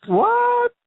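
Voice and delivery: high, loud squeak of disbelief; shocked disbelief. Retold or imitated. Retelling a coworker's reply, a high loud squeak of disbelief. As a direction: squeal it like you can't believe your ears.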